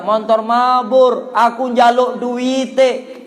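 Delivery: mild, drawn-out mock yell; retold group shouting. Retelling friends' cry to the plane, mild stretched shout. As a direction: imitate friends calling slowly to the plane. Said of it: "Saya teriak-teriak".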